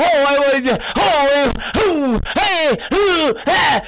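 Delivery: fast, high sing-song mockery; mocking gushing. Boss mocking employees who claim they love the company, gushing. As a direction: parody eager promises, running into silly oohs.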